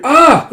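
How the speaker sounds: high, loud cry of shock; startled scream. Acted. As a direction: yelp at the blast. Cry at explosion.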